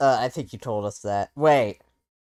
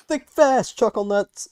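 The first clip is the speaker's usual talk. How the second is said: much higher, louder hammed-up taunt; mock taunt; 10 semitones higher, 4 dB louder, slower than usual. Taunting a teammate while throwing a flashbang, loud hammed-up taunt. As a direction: toss the line like a cocky bully.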